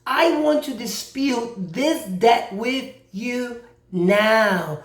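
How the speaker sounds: slow, deliberate dispute; measured, drawn-out statement; slow and clear. Shown as a demonstration. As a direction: spell it out word by word for the collector. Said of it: "you need to say it slow".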